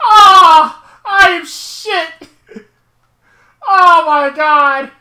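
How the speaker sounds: loud, high, drawn-out cry of real frustration; anguished self-blame. Real, not acted. Gamer cursing their own driving after a wreck, wailing. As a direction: wail it slowly at full voice, half laughing, half crying.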